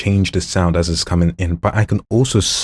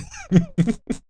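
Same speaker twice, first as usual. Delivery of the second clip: high giggle. Acted. Voice demo reel.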